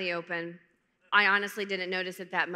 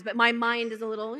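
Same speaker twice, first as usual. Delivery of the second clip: usual speaking voice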